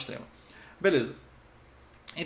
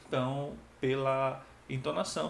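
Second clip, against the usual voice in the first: ordinary talk, nothing marked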